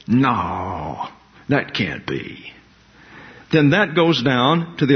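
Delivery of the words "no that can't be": low, slow voice of a doubting listener; skeptical dismissal. Acted. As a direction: act out a doubter, pitched well down and softer.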